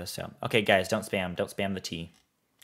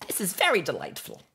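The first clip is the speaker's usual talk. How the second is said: high-pitched character voice; Mister Whiskers; 9 semitones higher than usual. Acted. Streamer performing a viewer's request, in Mister Whiskers voice. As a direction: put on a much higher character voice. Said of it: "in the Mister Whiskers voice".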